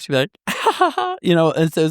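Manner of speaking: high slow squeaky giggle; a mock chipmunk giggle; imitated high voice-changer laugh; little laugh. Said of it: "she would always do this little laugh".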